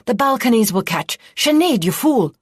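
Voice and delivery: loud high shouting, cracking up on the name; furious alarmed shout as the fire spreads; angry cry. A narrator's reading. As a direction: yell at her in alarm and rage. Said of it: "Ostville cried".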